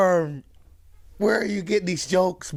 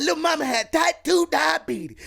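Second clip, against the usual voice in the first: mock shout; 10 semitones higher than usual. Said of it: "He said".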